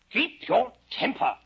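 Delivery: slow, high, loud pronouncement; caterpillar's pompous command. Acted. Storyteller as caterpillar advising Alice, loud and pompous. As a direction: boom each word out imperiously. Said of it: "he said".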